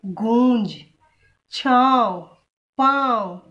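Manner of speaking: slow, drawn-out गूंज with the nasal hum held through the throat; nasal-throat demo. Shown as a demonstration. Teacher shows students the nasal chandrabindu sound, drawn out. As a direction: let गूंज, छाँव, पाँव ring through nose and throat together. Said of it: "नाक और गले दोनों से आ रही ना इसकी आवाज".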